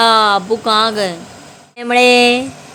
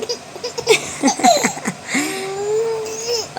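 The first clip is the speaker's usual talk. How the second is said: squealing laugh close to tears; high, tearful laugh; 9 semitones higher, 8 dB quieter than usual. Real, not acted. Mother laughing over her baby, high and half-crying. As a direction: laugh till it almost breaks into crying.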